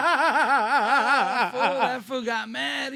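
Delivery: laughing out loud while telling it. Real, not acted. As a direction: crack up mid-story, then keep talking through the laugh. Laughing at the cop's reaction.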